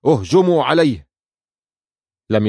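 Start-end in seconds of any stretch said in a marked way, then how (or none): 0.1-1.1 high-pitched royal battle cry; restrained narrator's shout, pitched up; shouted order. A narrator's reading. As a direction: cry out the attack command to the troops. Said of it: "صارخا".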